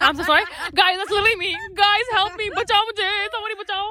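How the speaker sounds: hammed-up begging for help; tiptoeing boyfriend. Boyfriend tiptoeing around his mad girlfriend, mock pleading. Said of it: "tiptoe around her and be like".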